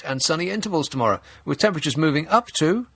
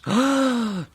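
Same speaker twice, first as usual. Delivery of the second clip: big, high theatrical gasp; exaggerated sharp intake of breath; mock gasp; 9 semitones higher than usual. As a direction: gasp in pretend astonishment. Feigned shock at coming temperature.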